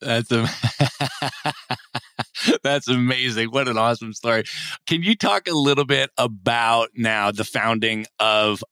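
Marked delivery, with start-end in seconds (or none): 0.5-2.6 airy rising laugh; amused laugh. Real, not acted. Host laughing at her monkey-business story. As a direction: laugh along with the guest.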